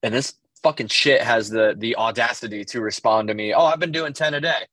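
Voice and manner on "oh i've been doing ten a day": mock-casual brag, pitched higher; retold casual brag. Friend answers his proud running text, mock-casual. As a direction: toss it off casually in a higher imitating voice. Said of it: "just casually".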